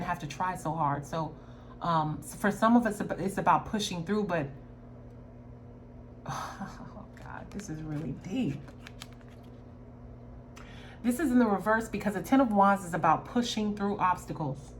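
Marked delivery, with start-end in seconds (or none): 6.3-8.6 sighing, awed. Real, not acted. Overwhelmed by the reading.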